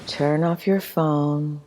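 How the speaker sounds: normal talking voice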